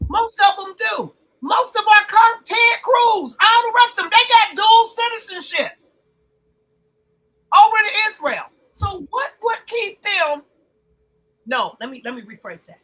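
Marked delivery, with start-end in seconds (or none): 0.1-10.4 loud, high-pitched insistence; worked up, voice lifted high and loud; heated rant. Real, not acted. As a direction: raise your voice and hammer it out. Heated rant about dual citizenship.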